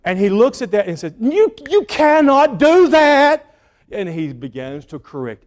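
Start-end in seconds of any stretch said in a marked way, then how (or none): 1.2-3.5 high, loud and slow father's rebuke; stern dad correction. Retold or imitated. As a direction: stop them mid-job, slow and firm.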